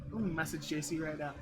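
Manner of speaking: muttered aside. Real, not acted. Muttering aside while quitting.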